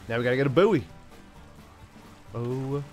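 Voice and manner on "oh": ordinary delivery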